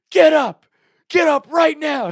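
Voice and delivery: very high, loud shout; parents shouting. Retold or imitated. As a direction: yell it like furious parents. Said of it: "parents are like".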